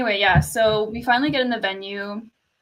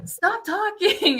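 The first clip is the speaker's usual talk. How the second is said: high, drawn-out mock telling-off; imitated scolding; 9 semitones higher, much slower than usual. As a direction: mimic a singer shushing a heckler, half laughing. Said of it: "That's when Hikaru was like".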